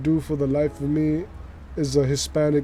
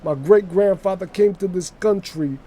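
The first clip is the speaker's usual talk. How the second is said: raised-pitch New York wise guy patter; wise guy storyteller; 5 semitones higher, 3 dB louder than usual. Pretend. Wise guy recalling family car trips, chatty put-on accent. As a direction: ramble like a mobster reminiscing. Continues invented story in character.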